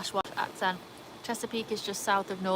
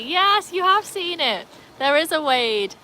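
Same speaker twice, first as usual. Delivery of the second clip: excited yes; 8 semitones higher, 6 dB louder, slower than usual. Real, not acted. Thrilled a viewer knows show.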